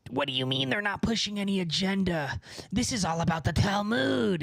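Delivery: imitating an indignant online commenter; mock freak-out. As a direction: put on a voice for someone freaking out. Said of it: "freaking out, like".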